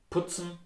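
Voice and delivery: Putzen with a hard P; hard consonants. Shown as a demonstration. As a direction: Standard German Putzen, short lax U. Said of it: "usually pronounced very hard".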